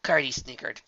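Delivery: usual speaking voice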